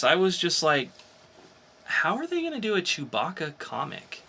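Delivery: ordinary delivery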